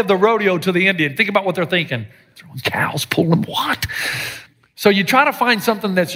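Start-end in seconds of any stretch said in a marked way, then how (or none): 2.4-3.9 whispered in mock horror. Preacher voicing a shocked Indian onlooker, whispered. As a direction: hush it like a shocked secret. Imagining Indian view of rodeo.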